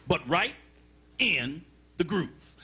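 low, paced-out finish with pauses; customer with attitude. Acted. Fussy diner tells the waiter exactly how, measured attitude. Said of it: "he had an attitude problem".

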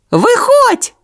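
very high shout; calling out. A narrator's reading. Sonya calls the echo out, high shout. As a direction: yell it down the hatch, high. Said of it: "крикнула Соня".

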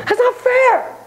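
put-on high, whining child's protest; high kid's wail of not fair; whiny kid. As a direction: whine it like a wronged kid. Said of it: "kids either thinking or saying".

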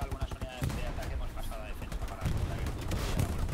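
rushed report. Real, not acted.

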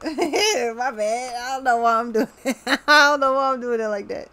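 real laughter breaking into the words; giggling. Real, not acted. Reader saying my bad for doing a voice, real giggling. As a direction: apologise through leftover giggles.

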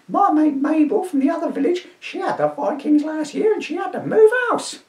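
put-on high shrieking old woman; an indignant old dear shooing a Viking; old lady to constable, high and indignant; crone voice. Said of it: "my crone voice".

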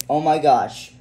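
loud shocked outburst; shocked gasp. Acted. Parent hearing Annie was turned into a boy, shocked loud outburst.

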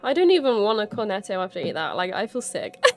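normal talking voice

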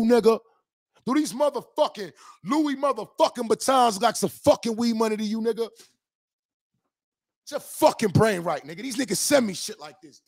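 heated, high-pitched tirade; ranting at a fan about his money; angry rant. Real, not acted. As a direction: keep railing at him, offended. Ranting at a critic over weed money.

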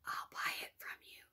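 breathy secretive whisper; sly whispered offer. Acted. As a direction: whisper it close so no one hears. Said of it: "the man whispered".

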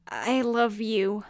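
slow, tender confession. Acted. Phoenix comforting dying rival.

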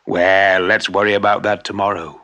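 a hint of a cheerful old craftsman; smiling shoemaker.

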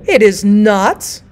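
put-on indignant old woman's drawn-out protest; screamed protest. A narrator's reading. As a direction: drag out each word, climbing to a high cry. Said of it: "screamed the old woman in bed".

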